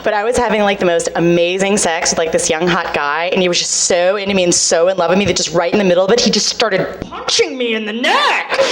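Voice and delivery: normal talking voice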